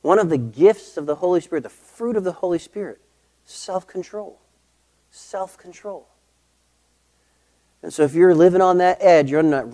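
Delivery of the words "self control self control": hushed emphasis. Real, not acted. Preacher dwells on the word.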